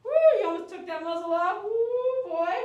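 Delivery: high loud woo of relief; relieved exclamation. Real, not acted. Groomer reacting after dog nearly tore off muzzle, loud and high. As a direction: blow out the near miss. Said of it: "Was he yelling at you?".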